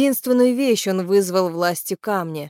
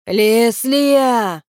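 drawn-out, higher cry, falling at the end; shouted call; 4 semitones higher, 6 dB louder than usual. A narrator's reading. Киран calls out to Леслия, shouting. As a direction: call the name out long and high. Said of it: "закричал Киран".

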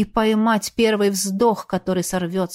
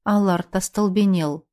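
plain reading voice

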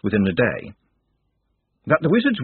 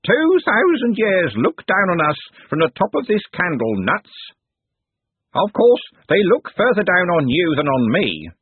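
higher and louder grand speech; self-important servant orating; pompous candle knave; 8 semitones higher, 3 dB louder than usual. Acted. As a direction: proclaim history, then sneer.

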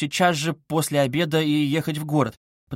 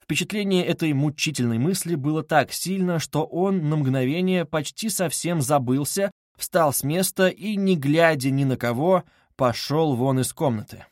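usual speaking voice

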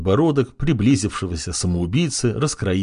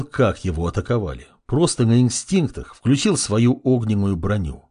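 usual speaking voice

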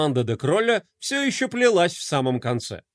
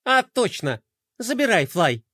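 offhand indifference; 4 semitones higher than usual. Acted. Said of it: "безразлично махнула рукой".

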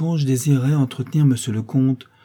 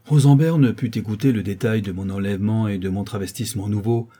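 usual speaking voice

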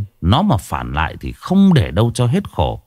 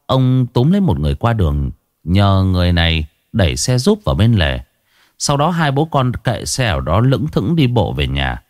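usual speaking voice

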